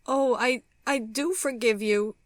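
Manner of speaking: high, loud, tearful outburst; crying hard. A narrator's reading. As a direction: blurt it through tears, pitched high. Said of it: "crying hard".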